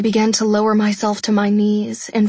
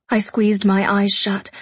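ordinary delivery

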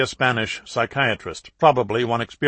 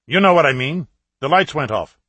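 higher, louder demanding voice; a raised, cold demand; demanding terrorist; 4 semitones higher, 6 dB louder than usual. A narrator's reading. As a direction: challenge him sharply down the line. Terrorist leader demands on speakerphone.

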